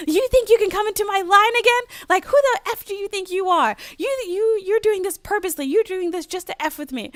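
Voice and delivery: hammed-up angry yell; mock angry cashier. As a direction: play the outraged cashier. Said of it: "they're pissed off at you".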